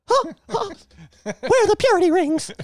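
high squeaky cartoon mouse taunt; Mickey Mouse voice. Mickey Mouse taunting the [name], squeaky.

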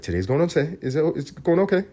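rising, nervous second-guessing; mock anxious worry. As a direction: fret over whether it's really okay. Anxious person voiced in a bit.

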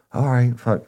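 high, exasperated give-in; exasperated informant. Retold or imitated. Informant caving to the cop, high exasperated blurt.

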